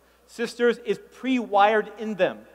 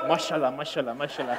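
low, soft, mumbled mashallah; mock low mumbling brothers; 8 semitones lower, 6 dB quieter than usual. Comedian mocks the brothers' mumbled praise, low. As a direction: grumble it like bored men. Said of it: "The brothers".

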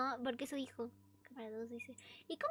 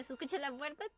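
ordinary talk, nothing marked

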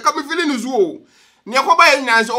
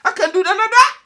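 laughing refusal; 10 semitones higher, 4 dB louder than usual. Retold or imitated. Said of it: "you say".

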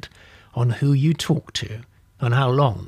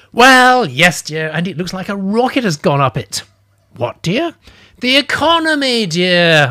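exasperated, higher and louder; 5 semitones higher, 7 dB louder, slower than usual. Acted. Narrator spluttering at the old lady about the economy, exasperated. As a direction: splutter it out in frustration. Narrator voicing himself in story.

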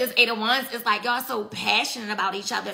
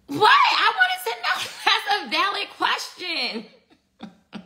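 laughing shout; 9 semitones higher, slower than usual. Real, not acted. Defending her nosy question to a viewer, laughing shout. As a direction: burst out laughing at her own nosiness.

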